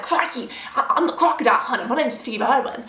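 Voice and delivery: badly faked Australian crocodile hunter; playful mock-Australian wildlife host; terrible Australian accent. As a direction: do a hammy, bad Australian accent. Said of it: "that was a terrible Australian accent".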